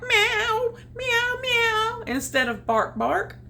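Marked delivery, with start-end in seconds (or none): 0.0-2.0 very high crying meows; high plaintive meowing dog. Acted. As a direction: mew like an upset kitten. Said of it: "meowing like a cat".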